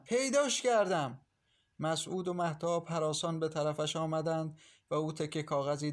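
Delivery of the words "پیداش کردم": higher, slower repeated cry; drawn-out triumphant yell, pitched higher; excited shout. A narrator's reading. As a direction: stretch out the second shout of پیداش کردم. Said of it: "با صدای فریاد ماهرخ".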